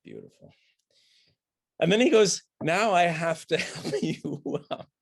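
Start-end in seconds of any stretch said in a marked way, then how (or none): 0.0-0.5 very low, soft sarcastic aside. Shown as a demonstration. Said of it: "beautiful is a absolutely sarcastic British word". 2.6-4.9 slow, high, hammed-up reluctance; reluctant, sarcastic. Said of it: "Like he doesn't want to".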